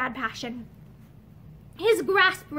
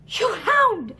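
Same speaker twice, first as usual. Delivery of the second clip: hoarse; 6 semitones higher than usual. A narrator's reading. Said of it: "he said again hoarsely".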